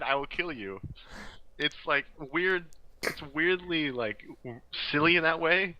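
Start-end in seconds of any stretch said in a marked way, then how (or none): none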